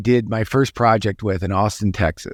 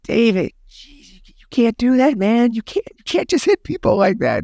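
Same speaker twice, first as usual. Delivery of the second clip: imitating his younger panicked self scolding David; pleading young guy; 12 semitones higher than usual. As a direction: beg him like a panicked kid. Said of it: "And I'm like".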